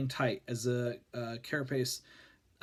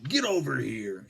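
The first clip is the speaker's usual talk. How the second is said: drawn-out Mortal Kombat battle cry; Scorpion voice; 3 dB louder than usual. Pretend. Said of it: "in the scorpion voice".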